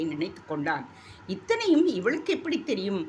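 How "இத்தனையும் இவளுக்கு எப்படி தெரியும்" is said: astonished. Acted. Prince's astonished thought.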